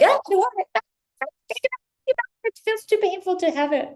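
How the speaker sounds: high, loud pained whine; mock anguished wail. Mocking a viewer who fears having what she wants. Acting out someone resisting abundance.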